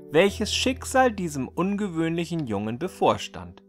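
ordinary delivery

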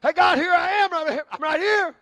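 loud, high call for attention; calling out to God. Retold or imitated. Preacher acting out calling up to God. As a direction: wave and call out to be noticed. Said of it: "I'm saying".

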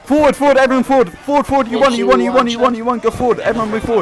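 loud, high, hyped battle cries; excited shouting. Real, not acted. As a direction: yell them on to victory. Commander hyped during winning fight.

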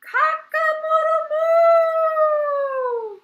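high, loud, very long final crow; cow and rooster crow. A narrator's reading. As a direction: stretch the moo out to wake the farm. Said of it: "they woke everyone up on the farm together".

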